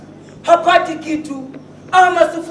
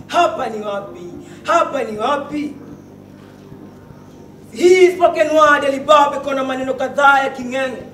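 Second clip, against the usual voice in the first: ordinary delivery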